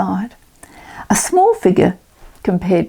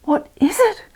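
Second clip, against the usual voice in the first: put-on high, startled little girl; a child's sudden alarmed cry; frightened girl; 11 semitones higher than usual. Molly clutches Mister Spells, high startled cry. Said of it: "cried Molly".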